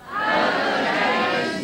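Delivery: the whole audience yells a greeting together; crowd shouting hello. Real, not acted. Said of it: "just say, "Hello, James".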